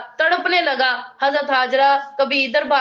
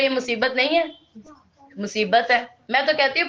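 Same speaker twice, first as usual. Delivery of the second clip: ordinary talk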